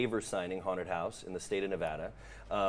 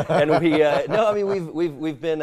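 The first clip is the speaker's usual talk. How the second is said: laughing; 6 semitones higher, 10 dB louder than usual. Real, not acted. Laughing over humble brag.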